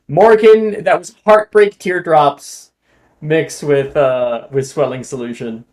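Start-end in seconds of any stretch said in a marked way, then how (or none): none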